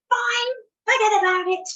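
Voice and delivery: high, loud burst of temper; exploding in anger. Retold or imitated. Mother losing patience with daughter at bedtime, exploding. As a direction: snap it out in a loud, high blast. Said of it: "You know, just exploded".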